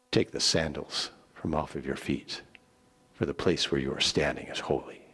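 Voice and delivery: a quiet, holy authority; solemn commander. Acted. The commander of the Lord's army telling Joshua, low and grave.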